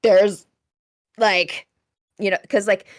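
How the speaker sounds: ordinary talk, nothing marked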